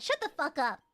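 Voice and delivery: normal talking voice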